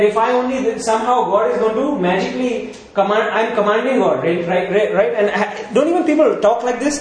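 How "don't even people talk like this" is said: normal talking voice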